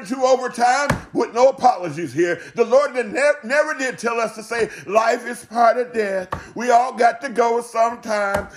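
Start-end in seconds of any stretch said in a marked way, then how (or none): none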